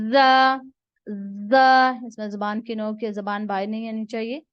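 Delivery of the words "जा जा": "जा" said loud and forceful with a hiss; loud drilled "जा" for the class; forceful letter demo. Shown as a demonstration. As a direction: hit "जा" hard, teacher modelling. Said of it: "इसको आपने जोर से अदा करना है".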